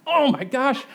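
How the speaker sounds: high, hammed-up awe; overwhelmed amazement. Dad learning his baby really laughed, overwhelmed. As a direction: react as if utterly blown away. Acts out being overwhelmed by news.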